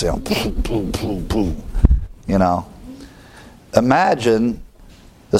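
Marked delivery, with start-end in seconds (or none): 0.2-1.6 soft imitated thump of car speakers; quiet poo poo bass beats; imitated booming bass. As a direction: mimic the bass pounding through a car window. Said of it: "their windows are going like this".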